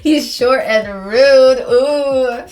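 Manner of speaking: very loud, slow, drawn-out jeering; hammed-up jeer. Real, not acted. Player mocking the rude neighbor, loud drawn-out jeer. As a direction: stretch SHORT and RUDE out loudly. Player hams up the line, laughing.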